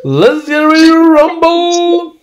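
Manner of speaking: high, slow, drawn-out hype call; ring announcer. Pretend. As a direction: stretch it like a fight announcer. Mock boxing ring intro.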